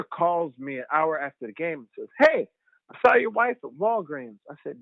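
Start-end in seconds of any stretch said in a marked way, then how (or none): 2.2-4.4 imitating mom. Mother voiced in story.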